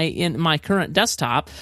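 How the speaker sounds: ordinary talk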